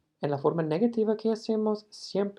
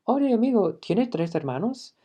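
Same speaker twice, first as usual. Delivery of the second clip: wrong casual example; 6 semitones higher, 5 dB louder than usual. Shown as a demonstration. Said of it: "no vamos a decir".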